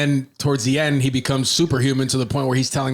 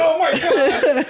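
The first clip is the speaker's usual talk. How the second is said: much higher, slow, laughing drawn-out; laughing amazement; 4 dB louder, much slower than usual. Retold or imitated. Friend reacting to the king's empathy, laughing amazed. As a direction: gasp it out in delighted shock. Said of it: "I was like".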